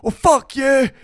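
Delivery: loud, high mock-angry retort; guttural outburst. Woman answering the wee dad back, guttural outburst. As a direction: explode into the swear word. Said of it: "such a guttural reaction".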